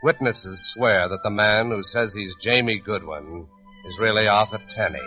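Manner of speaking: normal talking voice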